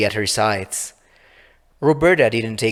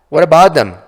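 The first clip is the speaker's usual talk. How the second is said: louder, higher, pressing demand; loud insistent question; 6 semitones higher, 10 dB louder than usual. Acted. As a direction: push the question hard. Darren presses Laura about hands.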